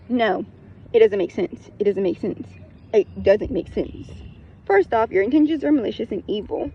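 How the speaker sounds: ordinary talk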